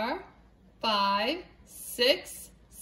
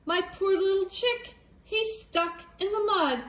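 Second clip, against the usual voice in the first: high worried hen voice; a mother hen fussing over her stuck chick; fretting hen; 6 semitones higher than usual. Acted. Hen telling of her chick stuck in mud, high and fretful. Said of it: "clucked the hen".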